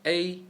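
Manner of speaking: A held high and level, first tone. Shown as a demonstration. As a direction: sing A high and flat.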